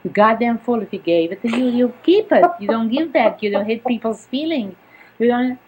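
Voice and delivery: loud, scolding big sister. Retold or imitated. Sister scolding her younger sister, loud and angry. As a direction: tell her off, loud and sharp. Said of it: "So my sister said to me".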